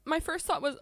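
normal talking voice

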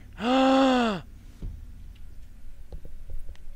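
sharp high gasp of fright; startled gasp. Real, not acted. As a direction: gasp in sudden fear. Startled in horror game.

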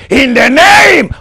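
high, drawn-out full-force cry; shouted invocation. Real, not acted. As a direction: stretch the invocation into a great shout.

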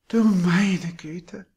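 slow, high, prim sigh of a landlady; affected little sigh. A narrator's reading. Landlady shaking her head at the passing years, affected sigh. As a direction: sigh it daintily, putting it on. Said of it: "mit einem gezierten kleinen Seufzer".

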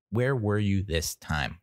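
slowed, level, pointed repeat. Retold or imitated. To the dog that let them down, slow pointed repeat. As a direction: drop back down and slow it.

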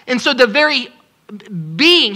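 ordinary talk

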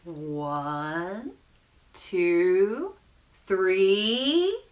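animated reading with rising, stretched suspense; children's story narration. A narrator's reading. Storyteller reading the penguin's bath time, animated narration. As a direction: lift and stretch the phrase endings for children. Reads picture book to children.

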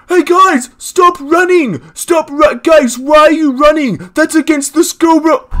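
high, loud nagging teacher impression; mock teacher. Teacher yelling at students fleeing bees, mocking high shout. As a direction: bark it like a fussy teacher scolding kids. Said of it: "this fucking teacher has the audacity to look at us and go".

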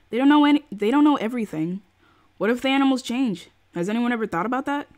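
heated, indignant objection; exasperated outburst. Acted. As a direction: argue back loudly, pushing each point. Lena argues back in the story.

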